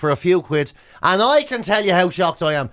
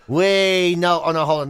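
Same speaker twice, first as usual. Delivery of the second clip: ordinary talk, nothing marked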